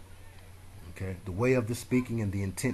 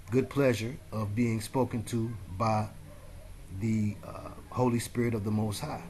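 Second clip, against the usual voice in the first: usual speaking voice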